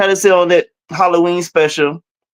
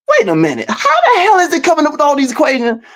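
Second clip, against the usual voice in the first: mild retold disbelief, pitch jumping up; incredulous; 8 semitones higher than usual. Recalling first doubts about the AI, incredulous. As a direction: voice your own past bafflement, higher. Retells being baffled by the answers.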